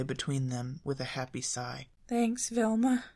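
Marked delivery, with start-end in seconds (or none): 2.1-3.0 Shaggy's thanks. A narrator's reading. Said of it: "Shaggy whispered".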